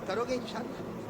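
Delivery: ordinary delivery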